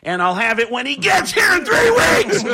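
screaming Kinison, very high and loud; Sam Kinison scream. Retold or imitated. Kinison impression peaks in a screaming rant. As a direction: build into the trademark Kinison shriek. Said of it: "it's Sam Kinison".

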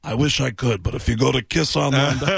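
deadpan rock star voice; dry, salesy brush-off. Retold or imitated. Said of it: "He goes".

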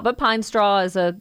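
normal talking voice

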